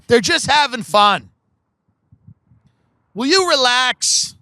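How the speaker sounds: a dad brushing it off, drawn out at the end; lightly voiced easygoing dad. Friend's dad tells mom to relax, lightly voiced easygoing dad. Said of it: "the dad would be like".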